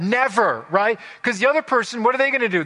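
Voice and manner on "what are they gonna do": ordinary talk, nothing marked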